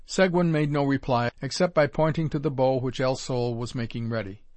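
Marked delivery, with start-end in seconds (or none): none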